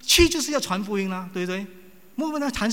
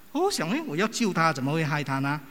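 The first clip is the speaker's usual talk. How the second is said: ordinary talk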